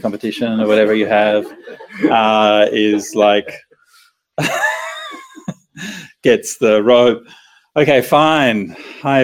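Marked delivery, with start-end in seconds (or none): 4.4-5.2 high squealing laugh. Real, not acted. Bursts out laughing at own joke.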